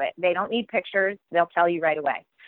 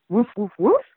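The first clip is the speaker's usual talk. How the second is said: barked woofs, a bit louder; dog barking; 5 dB louder than usual. Acted. Dog begging to go to school, playful barking. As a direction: bark the words like the dog Bow Wow. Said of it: "says Bow Wow".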